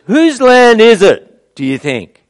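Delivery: high, loud, slow and lordly; a high, booming boast from a proud man; arrogant question. Retold or imitated. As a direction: lord it over David, stretching each word. Voicing Abner's arrogant question.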